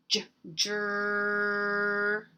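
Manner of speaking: "jur" held long on the R; sounding out. Shown as a demonstration. Teacher helping children hear the R, stretched sounding out.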